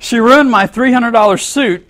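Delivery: hammed-up fury over a ruined suit; mock outraged customer. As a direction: customer in dressing yells at the manager, mock angry. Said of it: "He said".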